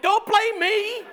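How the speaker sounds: high, drawn-out squeal of protest; mock indignant protest. Retold quarrel with his daughter.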